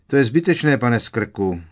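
calling after. A narrator's reading. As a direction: shout after him as he leaves. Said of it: "křikl za ním pan Digory".

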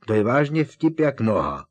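Harry cuts off a lovesick friend, impatient. A narrator's reading. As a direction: snap him out of his swooning. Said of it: "netrpělivě Harry".

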